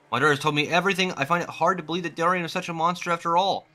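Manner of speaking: a hint of a relieved mother passing on news; lightly voiced grateful mother. Reads grateful mother's lines.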